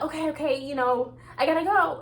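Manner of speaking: mother hurrying off to work; light imitation of a rushed parent; hurried leaving. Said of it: "then I'm like".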